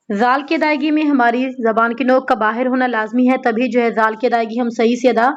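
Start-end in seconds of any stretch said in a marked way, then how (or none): none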